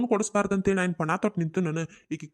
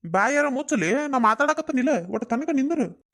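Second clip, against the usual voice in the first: higher-pitched exasperated telling-off; annoyed plea to wait; irritated hushing; 6 semitones higher than usual. Acted. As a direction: shut down the interruption, voice pitched up. Snaps at interrupter to keep quiet.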